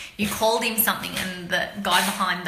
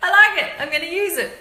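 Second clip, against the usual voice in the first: higher, louder, pleased customer voice; a hint of a gleeful salesman; delighted shopkeeper; 8 semitones higher, 5 dB louder, faster than usual. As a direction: play the chuffed shop guy. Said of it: "And the guy goes".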